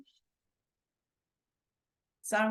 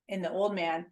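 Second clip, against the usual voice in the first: ordinary talk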